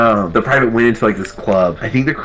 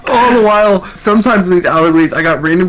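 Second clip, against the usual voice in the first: excited; 12 semitones higher, 5 dB louder than usual. Real, not acted. Excited storytelling peak.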